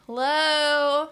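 loud, high call through a door. Acted. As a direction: call out to whoever is inside. Calling through a closed door.

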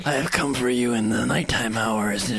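loom over them like death; grim reaper voice. Acted. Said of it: "I'm gonna keep murder my voice".

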